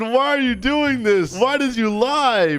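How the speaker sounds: high, drawn-out mock pleading; acted distress, pitched well up; distraught child. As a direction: whine it like a betrayed kid.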